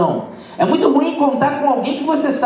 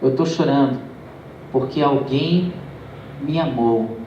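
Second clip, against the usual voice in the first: put-on moved old man; 10 semitones lower than usual. Old man explains his crying.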